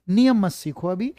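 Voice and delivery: ordinary talk, nothing marked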